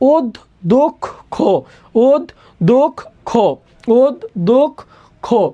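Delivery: "ওদ দোক খো" as od, dok, kho with a pause after each; syllable by syllable. Shown as a demonstration. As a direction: spell out the sound in separated beats, "ওদ", "দোক", "খো". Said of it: "তাহলে উচ্চারণটা হবে".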